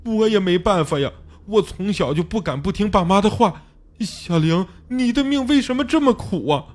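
high-pitched helpless wail; desperate crying. A narrator's reading. Xiao Li mourns his wife to his friend, wailing. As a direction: lament her fate, rising into a wail. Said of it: "小李哭得快昏过去了".

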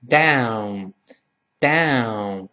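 "down" stretched, opening wide then closing; slow teaching model of "down"; open-then-close diphthong. Shown as a demonstration. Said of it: "所以先张开".

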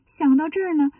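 ordinary delivery, nothing marked

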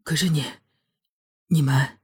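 stunned, trail off between fragments; stammering. A narrator's reading. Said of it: "结结巴巴地说道". (结结巴巴、说不下去)

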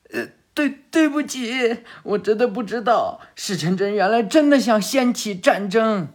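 put-on high, hurried, stammering grateful boy; timid underling. Defeated lackey thanking Sarada for healing him, high nervous voice.

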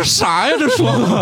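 baffled exclamation. Retold or imitated. Friend retells hearing blind users' 10x speed audio, mock-baffled. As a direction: act out being totally lost by the fast audio.

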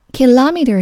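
Kilometer as ki-LOM-eter; Kilometer with second-syllable stress, American style. Shown as a demonstration. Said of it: "是美式发音".

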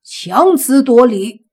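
the furious father scolds his daughter, loud and slow; angry rebuke. Acted. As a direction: spit it out word by word in anger. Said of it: "中年人大怒".

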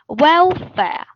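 welfare with WEL stressed and fare weak and short; stress on well. Shown as a demonstration. Teacher demonstrating welfare with stress on well. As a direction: hit well, swallow fare. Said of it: "重音符号呢，在well这个位置上".

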